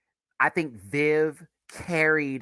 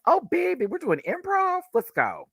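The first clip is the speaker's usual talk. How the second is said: high, squealing mock thrill; acted excitement. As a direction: shoot way up in pitch, thrilled to jump in. Acting out eagerness for improv.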